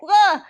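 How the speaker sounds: loud, high mock child's cry; a quick hammed-up baby howl; toddler wail. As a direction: wail like a startled three-year-old. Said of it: "the three-year-old's like".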